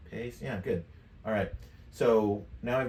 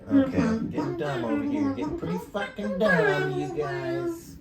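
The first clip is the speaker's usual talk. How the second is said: extremely high pitch-shifted chatter; super high voice. Shown as a demonstration. Streamer fiddling with buttons, super high voice effect. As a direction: talk through a tiny, squeaky pitch effect. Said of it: "it's super high voice".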